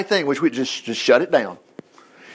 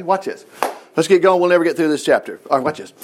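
normal talking voice

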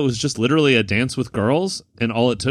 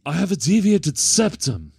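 deadpan comic German henchman, pitched up then dropping at the end; German accent; 3 semitones higher than usual. Acted. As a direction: announce it stiffly in a mock German accent. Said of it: "his delivery is so fucking funny".

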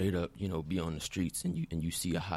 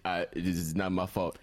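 normal talking voice

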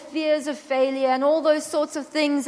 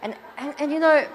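ordinary talk

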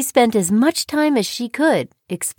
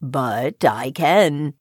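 low, slow frog; deep, unhurried reply; 9 semitones lower, 3 dB quieter, much slower than usual. A narrator's reading. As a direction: counter calmly, deep and drawn out. Frog counters the disbelieving girl.

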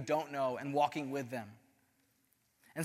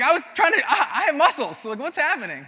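much higher and louder, laughing disbelief; overwhelmed outburst; 9 semitones higher, 9 dB louder than usual. Retold or imitated. To Rich about the gift shoes, loud high laughing disbelief.

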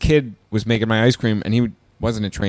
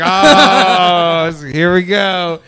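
louder, higher, exasperated laugh; 7 semitones higher, 7 dB louder, much slower than usual. Retold or imitated. Hearing the manager's covid excuse, exasperated laughing groan. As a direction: roll your eyes and laugh it off.

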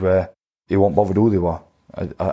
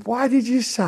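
trainer's question; 14 semitones higher than usual. Retold or imitated. Trainer Glyn's retold question.

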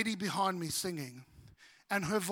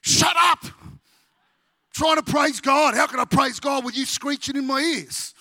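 loud, exasperated snap of shut up; imagined rebuke to a bad singer behind him; annoyed outburst; 4 semitones higher, 9 dB louder than usual. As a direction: tell the warbling lady off loudly. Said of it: "turning around and saying".

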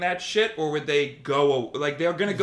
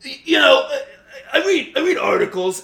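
much higher, laughing, defensive stammer; mocking flustered troll; 11 semitones higher, 8 dB louder than usual. Imitating a flustered anti-vaxxer defending himself, laughing. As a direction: play a sputtering know-nothing, laughing. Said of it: "they come back like".

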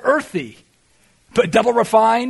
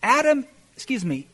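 ordinary talk, nothing marked

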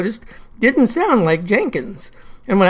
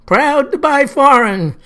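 slow, higher call out the window; hollered; 5 semitones higher, 3 dB louder, slower than usual. Retold or imitated. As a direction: wave and holler it, a touch mocking.

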